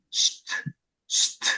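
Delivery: SHT with no vowel at all; intento impronunciable. Shown as a demonstration. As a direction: try to force out "Sht" as bare consonants. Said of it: "No se puede pronunciar". ("Sht" impronunciable, sin vocal)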